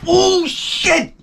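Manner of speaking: imitating mother's high-pitched swear; hearty motherly curse. Saintly mother stubbing her toe, high hearty curse. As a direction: let one swear burst out, very high. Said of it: "you get a hearty shit out of it".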